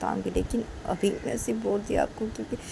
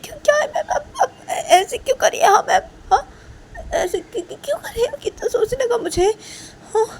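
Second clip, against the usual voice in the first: much higher, loud tearful pleading; crying student; 10 semitones higher, 6 dB louder than usual. Acted. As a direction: sob out the questions. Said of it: "तो काजल".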